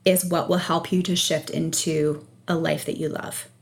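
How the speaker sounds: ordinary talk, nothing marked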